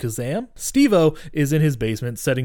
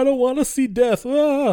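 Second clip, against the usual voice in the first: high-pitched hammed-up panic; exaggerated high wail ending in a cry; mock freak-out; 12 semitones higher, 4 dB louder than usual. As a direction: imitate a kid wailing in terror. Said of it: "Losing his shit".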